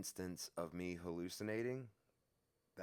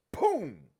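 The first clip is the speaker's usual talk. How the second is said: shouted, much higher and louder; loud boom; 12 semitones higher, 14 dB louder than usual. Retold or imitated. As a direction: bang it out like the box hitting the floor. Box slammed onto the cell floor.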